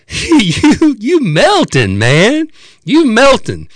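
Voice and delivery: loud, high, drawn-out stoned wonder; imitating a tripping friend's amazed cry; awed tripper. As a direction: insist slowly, like a man hallucinating. Dazed tripper repeats it to cop.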